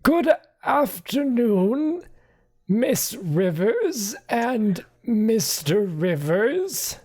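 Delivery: high, slow stiff butler voice; a prim, put-upon manservant; slow and high, stiffly formal; bewildered butler Archimedes. Acted. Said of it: "the bewildered man says".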